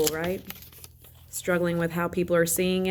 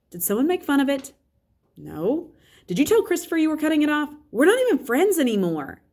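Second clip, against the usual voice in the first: sister; 9 semitones higher than usual. A narrator's reading. Sister voiced in story.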